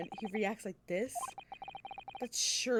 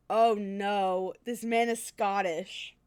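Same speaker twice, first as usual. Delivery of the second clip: dismayed outcry; 7 dB louder, much slower than usual. Real, not acted. Dreads having to voice Scot.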